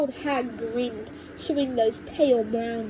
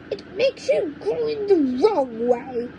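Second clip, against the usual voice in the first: snapped; 6 semitones higher, 4 dB louder than usual. A narrator's reading. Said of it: "she snapped".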